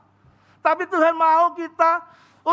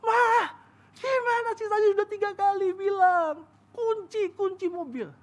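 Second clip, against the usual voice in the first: higher-pitched mock nagging; scolding wife; 7 semitones higher than usual. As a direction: scold the wife for forgetting the keys. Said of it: "Saya ngomel sama istri saya". (suami mengomeli istrinya dengan suara tinggi)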